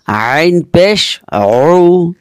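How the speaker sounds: আইন পেশ উ stretched very slowly; slow recitation; slow drawn demo. Shown as a demonstration. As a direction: draw out উ. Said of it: "উচ্চারণ একই হবে".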